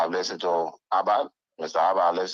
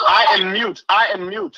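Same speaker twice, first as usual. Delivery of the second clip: loud insistence; 13 semitones higher, 7 dB louder than usual. Real, not acted. Caller insists over the line.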